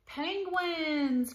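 thrilled storybook cry; excited cry. A narrator's reading. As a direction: call it out like an eager little cat. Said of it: "cried Splat".

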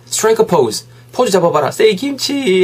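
playful photographer's prompts, rising high; high, lively "say cheese" call; lightly acted photographer. Acting out photographing a friend.

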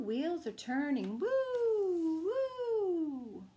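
gentle bedtime story reading; reading to children. Acted. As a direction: bedtime picture book read-aloud. Reading picture book aloud.

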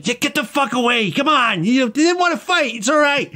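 high, harried put-on voice; [name]/character. Character pushes the angry crowd away, high and pleading. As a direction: shoo people off in a strained high pitch. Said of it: "[name]'s going".

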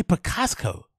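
imitating Picasso with an extra k, "pi-KAS-ko"; mock the slip, Picasso as "Picasco"; mispronounced Picasso. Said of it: "did you hear how you pronounce".

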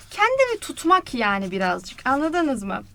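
ordinary talk, nothing marked

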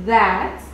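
"that" with voiced English th; English TH sound. Shown as a demonstration. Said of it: "Como en su ejemplo". ("that" con la th sonora del inglés)

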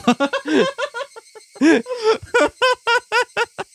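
long high-pitched burst of laughter. Real, not acted. Laughing at genre gag.

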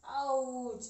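high sharp ouch; pained ouch. Real, not acted. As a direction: yelp from a side stitch. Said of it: "I can't even laugh".

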